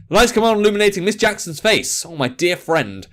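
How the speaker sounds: ordinary delivery, nothing marked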